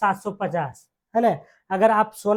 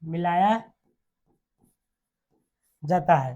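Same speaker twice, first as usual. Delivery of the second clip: word by word, long pauses; dictation. Real, not acted. Dictating notes to students.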